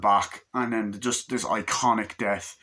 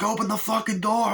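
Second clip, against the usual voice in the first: much higher, yelled mock thug demand; yelling gunman; 12 semitones higher than usual. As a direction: bark it like a thug banging on the door. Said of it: "and the guy's like".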